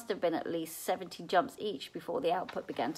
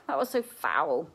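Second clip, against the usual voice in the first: disgusted; 4 dB louder than usual. A narrator's reading. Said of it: "complained Seth".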